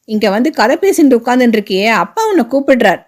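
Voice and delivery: ordinary delivery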